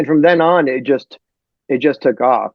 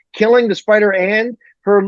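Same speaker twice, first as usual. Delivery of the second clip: normal talking voice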